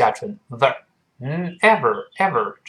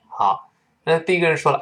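ordinary talk, nothing marked

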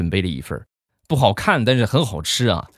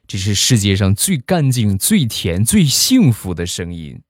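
usual speaking voice

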